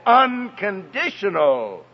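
"unconditional" stretched out slowly; stress on unconditional. Real, not acted. Preacher on the child's acceptance and love, stressing "unconditional". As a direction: lean hard on "unconditional", drawing it out. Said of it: "And I stress that word".

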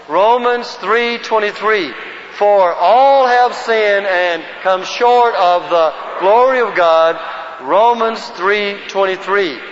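slow, measured verse recitation; group recitation lead. Shown as a demonstration. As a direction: lead a room in reciting, word by word.

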